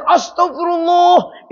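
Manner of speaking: high, slow shocked gasp of prayer; startled astagfirullah. Retold or imitated. Husband waking late, crying out in shock. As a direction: cry it out as he wakes too late.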